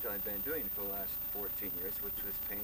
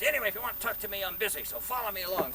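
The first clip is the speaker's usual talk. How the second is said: loud, brusque, high-pitched dismissal; imitated gruff busy painter; Ross Ward, mean; 10 semitones higher, 8 dB louder, faster than usual. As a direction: brush someone off impatiently. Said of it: "this guy's so mean".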